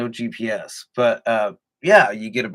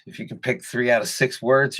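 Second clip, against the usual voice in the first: normal talking voice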